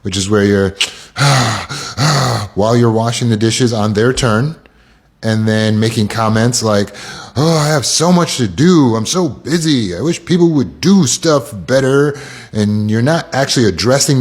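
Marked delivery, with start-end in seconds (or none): 1.1-2.5 heavy, put-on sigh; huffy acted sigh; passive-aggressive sigh. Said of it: "Then we get into passive aggressive". 7.4-12.1 mock put-upon grumbling, pitched up; acted martyr's moan with swooping tune; passive-aggressive. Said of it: "Then we get into passive aggressive".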